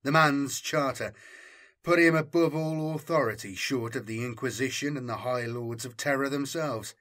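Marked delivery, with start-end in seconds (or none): none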